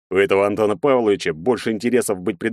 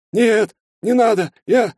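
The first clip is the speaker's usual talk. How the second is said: much higher, slow, tearful pleading; near tears; 5 dB louder, slower than usual. A narrator's reading. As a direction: beg in a thin terrified voice. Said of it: "Сержант едва не плакал". (почти плача, высоким голосом)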